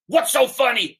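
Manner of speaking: high-pitched, indignant demand; acted outburst of a furious king. Furious king confronts the laughing beggar man, indignant. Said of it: "The king is furious".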